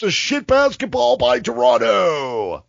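high, gushing announcer parody; mock excited broadcaster. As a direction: ham up a thrilled TV commentator.

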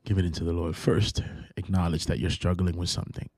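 normal talking voice

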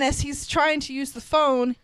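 usual speaking voice

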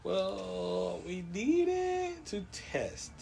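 high, drawn-out singsong insistence; imitated nurse. Nurse insisting on a stool sample, high drawn-out coaxing. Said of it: "She goes".

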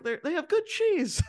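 badly faked accent, squeaked up high for a laugh; Kevin Patrick. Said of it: "I can't do his accent".

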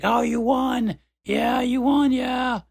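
high, loud freaked-out cry; crazed high excited shout; freaked out excitement. Retold or imitated. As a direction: yelp it like you can't believe he lost. Stranger freaks out at loss.

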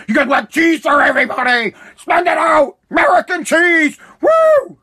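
high, loud hammed-up celebration; mock rowdy cheering. Mimicking fans as Biden eats cheese, rowdy cheering. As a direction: holler it like a crazed fan, ending in a whoop.

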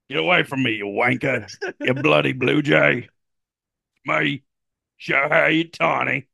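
mock-indignant Cockney brush-off; Cockney British. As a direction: send them packing in broad Cockney. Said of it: "Cockney British?".

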